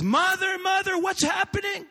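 high, loud call for mother; panicked daughter shouting; frantic shout. Acted. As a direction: cry out to her mother in alarm. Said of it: "the girl shouts".

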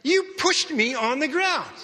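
outraged what just happened; shocked disbelief. Retold or imitated. Knocked to the floor by the praying man, high indignant protest. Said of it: "I'm not yelling this, but in my mind".

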